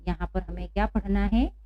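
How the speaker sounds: ordinary delivery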